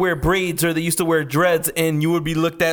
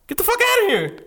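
mock disbelief; 14 semitones higher than usual. Scoffing at the imitated claim.

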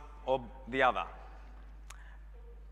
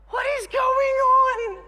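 much higher, louder, drawn-out shocked cry; stunned shout; 9 dB louder, much slower than usual. Retold or imitated. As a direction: yell it in total bewilderment. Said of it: "they're just like".

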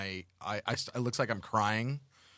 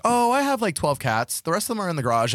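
higher, breezy friend voice; mock casual friend; 7 semitones higher, 5 dB louder than usual. Friend casually admits to twelve cats, breezy higher voice. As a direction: toss it off lightly like it's nothing. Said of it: "She's like".

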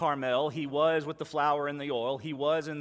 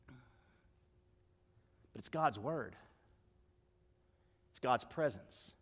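very slow, softer, leaning on "God's"; preacher slowing right down on God's word; slow, soft emphasis; 5 semitones lower, 9 dB quieter, much slower than usual. Real, not acted. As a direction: let "God's word" land slowly and quietly.

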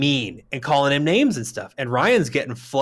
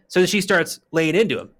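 normal talking voice

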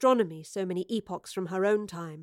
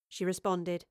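plain reading voice

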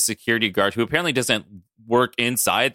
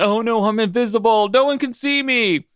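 mock panic; 7 semitones higher than usual. Voicing running man screaming.

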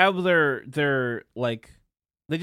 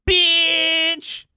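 high, loud, drawn-out yell; mock parting shout; 9 dB louder than usual. Streamer yelling before jumping from helicopter, drawn-out high shout. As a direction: imitate a gamer yelling one long insult. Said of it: "before he jumps out the helicopter, he just goes".

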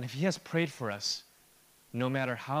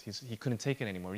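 ordinary delivery

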